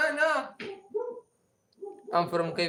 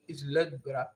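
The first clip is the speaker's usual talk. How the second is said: usual speaking voice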